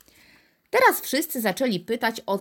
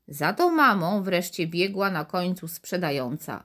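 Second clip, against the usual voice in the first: ordinary delivery, nothing marked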